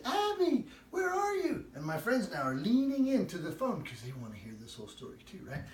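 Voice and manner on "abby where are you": calling out. Retold or imitated. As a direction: call for the lost child. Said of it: "and she says".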